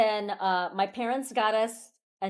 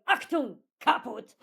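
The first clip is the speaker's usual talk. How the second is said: German game voice; 3 semitones higher than usual. Retold or imitated. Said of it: "it going".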